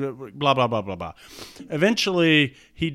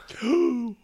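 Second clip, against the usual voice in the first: mock gasp; 11 semitones higher than usual. Reacting to the plot twist.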